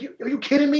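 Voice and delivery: high, mock-outraged disbelief; incredulous retort.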